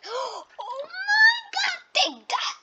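high, slow gasping cry; gasping excited shout. Real, not acted. Gamer shrieking as he strikes back in battle. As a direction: shriek it out in the heat of the fight. Gamer hit hard mid-fight.

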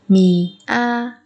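slow, separated "Mianata"; syllable by syllable. Shown as a demonstration. Teacher modelling a word for learners, slowly spaced out. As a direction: pause between each syllable of "Mianata".

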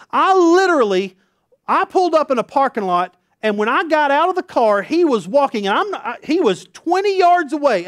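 loud, high, breathless telling; high, loud excited storytelling. Real, not acted. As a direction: build it up like the punchline is coming. Excited retelling of Randy's cologne.

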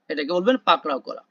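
ordinary delivery

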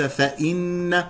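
"فإن" said fa-inna, with i on the hamza; kasra on hamza. Shown as a demonstration. Teacher showing the misreading, "فإن" as fa-inna. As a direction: say "فإن" with a clear i after fa. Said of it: "وليس".

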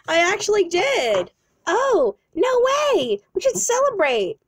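high, swooping excitement; play-acted delight. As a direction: squeal over happy news. Couple celebrates the new job.

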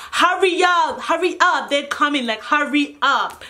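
usual speaking voice

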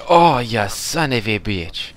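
loud, slow, frustrated curse; angry. Real, not acted. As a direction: curse out the enemy who got you. Just got killed in game.

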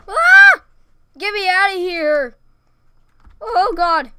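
high, loud terrified cry; panicked scream. Real, not acted. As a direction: scream to get out. Panicked escape.

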